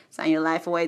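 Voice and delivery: lightly laughed, spoken warning; laughing mock warning. Joking about FBI contract, laughing spoken warning.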